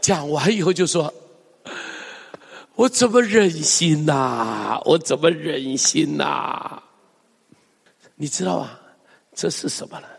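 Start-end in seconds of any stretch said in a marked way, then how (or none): 2.7-6.8 slow, drawn-out lament; Hosea's sigh. Acted. Preacher sighs as Hosea unable to bear it, lamenting. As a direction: sigh the question with heartache. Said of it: "这不就是何西阿的叹息吗".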